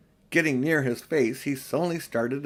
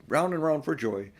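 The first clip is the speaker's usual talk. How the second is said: plain reading voice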